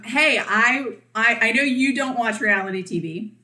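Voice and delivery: normal talking voice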